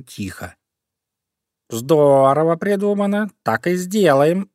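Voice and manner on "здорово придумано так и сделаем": higher, delighted cat voice; pleased meow. A narrator's reading. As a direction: purr out sly approval. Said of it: "мяукнул кот Васька". (довольное мяуканье)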